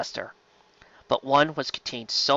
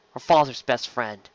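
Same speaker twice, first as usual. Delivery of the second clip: usual speaking voice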